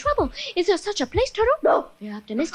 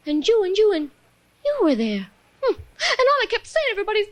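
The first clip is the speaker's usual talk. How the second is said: normal talking voice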